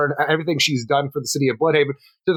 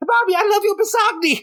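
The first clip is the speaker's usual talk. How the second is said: put-on very high little-kid voice; hammed-up small boy's delight. Little boy telling his mom he loves dinner, high childish glee. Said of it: "since I'm a little boy".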